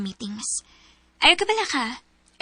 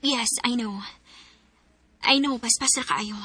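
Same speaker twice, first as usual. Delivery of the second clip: normal talking voice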